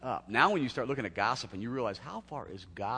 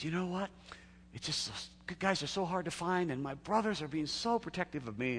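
put-on lifted, higher young woman's voice; higher, chatty woman confiding; girl on the phone; 7 semitones higher than usual. Preacher mimics a woman caller, pitched higher. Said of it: "the girl says".

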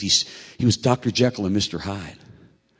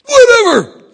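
loud, high mock yell; angry teen outburst; 16 dB louder, slower than usual. Son to dad before slamming his bedroom door, angry yell. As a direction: explode it and storm off.

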